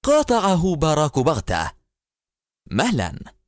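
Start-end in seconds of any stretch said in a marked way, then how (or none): 2.7-3.4 curt interruption. A narrator's reading. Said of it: "لهذا الأسلوب الفظ".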